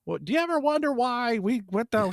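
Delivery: high, whiny stand-up comic impression; Jerry Seinfeld. Comedian opening an observational bit, hammed-up Seinfeld impression. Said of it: "you gotta sound like Jerry Seinfeld".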